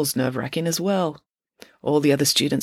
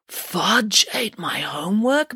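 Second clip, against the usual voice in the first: slow, higher-pitched amazed question; marvelling; 4 semitones higher, slower than usual. A narrator's reading. Parker learns the school dog ate it, marvelling. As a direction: take it in slowly, in wonder. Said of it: "marvelled Parker".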